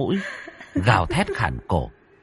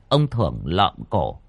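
ordinary delivery

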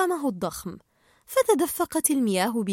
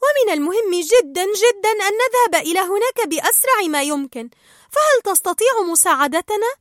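high, loud, breathless urgency; Dory explaining; 8 semitones higher, 6 dB louder than usual. Acted. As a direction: rush to ask the little fish for help. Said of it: "استكملت دوري". (دوري تشرح بإلحاح وبصوت عالٍ)

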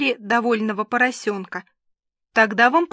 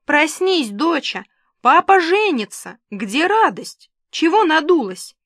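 high, loud, gushing father; bursting with wedding news; high and loud, thrilled dad; excited; 7 semitones higher, 6 dB louder than usual. A narrator's reading. Father announces wedding to daughter.

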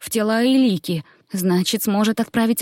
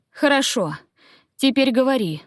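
higher, tense interrogation; demanding; 6 semitones higher than usual. A narrator's reading. Queen questioning druid held at knifepoint, demanding. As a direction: press the captive to talk.

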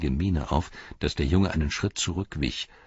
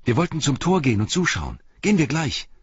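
high, loud and eager; eager boy; 10 semitones higher, 5 dB louder than usual. A narrator's reading. Boy urges Pippin to come to the gate, high and eager. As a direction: hurry him along.

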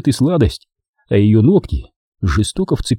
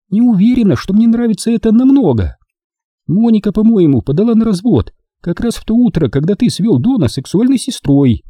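put-on higher young woman's voice, teasing; Дженни; 11 semitones higher than usual. A narrator's reading. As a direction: play the girlfriend, sweet and teasing. Jenny voiced. (нежная игривая Дженни)

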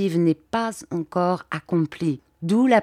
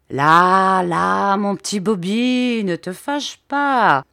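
soothing calm-down; 4 dB louder, slower than usual. Acted. Calming an angry Bobby.

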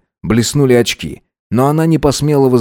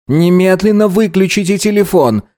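high, raised nervous cry; nervous shout; 10 semitones higher than usual. A narrator's reading. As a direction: shout it in a panic. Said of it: "нервно крикнула женщина".

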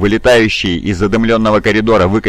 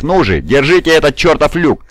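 higher-pitched, frustrated battle order; urgent yell; 6 semitones higher than usual. A narrator's reading. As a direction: urge the men to hold. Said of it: "вопил Дев". (отчаянная команда криком)

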